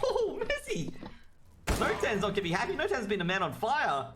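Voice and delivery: high-pitched burst of amazement at the play; excited play-by-play. Real, not acted. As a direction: call the big kill at a high, thrilled pitch. Live match commentary, big play.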